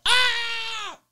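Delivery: loud high startled group shout; whole room yelling in shock. Retold or imitated. Audition room yelling as the gun comes out, startled.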